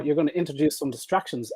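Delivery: ordinary talk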